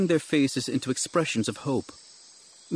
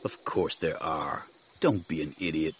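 cool rebuff; 7 semitones lower, 7 dB quieter than usual. Acted. Jedi correcting agitated companion, cool rebuff. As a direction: dismiss him softly and low.

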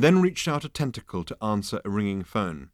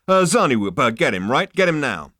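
loud, higher, pushy order; impatient Zaphod; 5 semitones higher, 7 dB louder than usual. Acted. Zaphod ordering the receptionist, loud and impatient. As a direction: shove the demand at her.

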